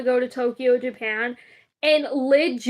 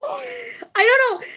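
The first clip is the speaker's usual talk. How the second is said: laughing; 10 semitones higher than usual. Real, not acted. Laughs off the accent talk.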